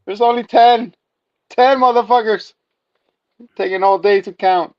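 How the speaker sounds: high, loud griping; mock exasperation. Villager griping that counting takes all day, mock exasperated. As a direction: complain up high in hammed-up disbelief.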